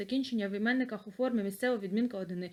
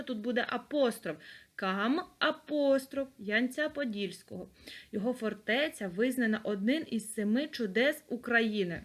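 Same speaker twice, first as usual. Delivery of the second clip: ordinary talk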